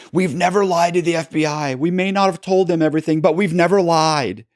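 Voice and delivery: high, pushy indignant denial, a big step up in pitch; husband jumping up to deny lying to the FBI, indignant and high. Retold or imitated. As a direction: play the offended husband insisting he never lied. Pete jumps up, denies lying.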